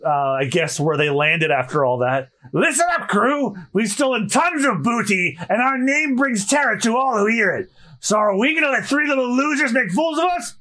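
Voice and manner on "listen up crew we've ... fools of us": high-pitched villainous rallying speech; Buggy rallying his crew. Acted. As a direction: address the whole crew like a showboating captain.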